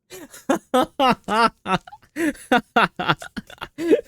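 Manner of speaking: high, loud helpless laughter; long burst of real laughter; laughing hard. Real, not acted. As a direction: crack up at your own joke. Cracks up at own impression.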